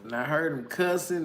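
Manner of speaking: ordinary talk, nothing marked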